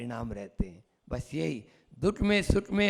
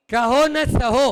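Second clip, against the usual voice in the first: high, loud, measured verse delivery; haiku recitation; 4 semitones higher, 6 dB louder, slower than usual. Shown as a demonstration. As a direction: declaim it as a line of haiku. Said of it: "haiku है".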